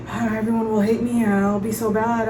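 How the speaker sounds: put-on anxious whine, run together; mock fretting. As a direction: imitate a friend spiralling into self-doubt. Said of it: "in her own twisted world where she's like".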